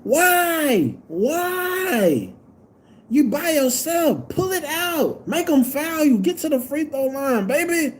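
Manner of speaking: high, exasperated pleading rant; worked-up fan rant; angry ranting. Real, not acted. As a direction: berate the player in disbelief. Fan berating player after bad shot.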